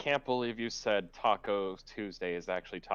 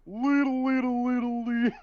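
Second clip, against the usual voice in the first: silly squeaky voice; 5 dB louder than usual. Acted. Spooky setting, silly voice.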